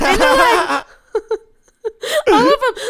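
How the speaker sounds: talking through laughter; laughing. Real, not acted. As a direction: laugh as you say it. Laughing retelling the guys' reaction.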